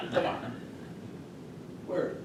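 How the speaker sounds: usual speaking voice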